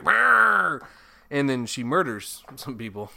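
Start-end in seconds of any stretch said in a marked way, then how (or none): 0.0-0.8 loud, high revving noise; mouth-made speedboat roar; imitated engine roar. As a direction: rev like a speedboat motor.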